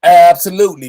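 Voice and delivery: loud affirmation. Real, not acted. Answers the protest report.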